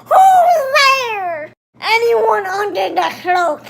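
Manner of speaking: high, loud janitor yell; laughing mock shout; angry shout. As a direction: bark into the dark at intruders. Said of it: "shouting angrily".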